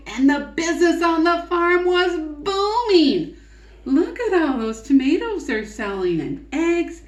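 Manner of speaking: put-on lively read-aloud to children; story narration. A narrator's reading. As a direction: tell it like a picture book for kids.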